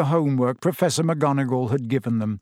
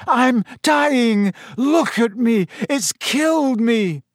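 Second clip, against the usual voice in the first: high, loud melodramatic wailing; panicked yell; 9 semitones higher, 4 dB louder, slower than usual. A narrator's reading. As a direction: yell as if mortally wounded. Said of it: "Malfoy yelled as the class panicked".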